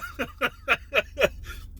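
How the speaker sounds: quick run of high, soft laughs; laughing. Real, not acted. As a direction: chuckle at his own joke. Laughs at own combat impression.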